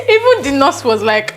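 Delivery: normal talking voice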